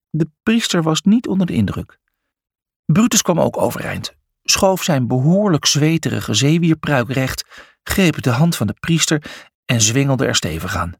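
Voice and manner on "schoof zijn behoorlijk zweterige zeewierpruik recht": plain reading voice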